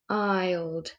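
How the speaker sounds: ordinary talk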